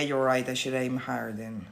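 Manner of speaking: ordinary talk, nothing marked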